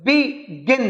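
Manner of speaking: "begin" in two beats, be, gin; split into syllables. Shown as a demonstration. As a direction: break "begin" between "be" and "gin". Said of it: "अब ये एक syllable के word नहीं है".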